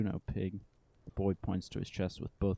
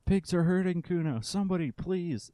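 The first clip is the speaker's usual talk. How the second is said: put-on much higher, loud child screaming for help; shrill hammed-up cry of distress; full-blast wail; 10 semitones higher, 6 dB louder than usual. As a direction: scream it to the whole street, fake terror. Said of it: "It's full blast now".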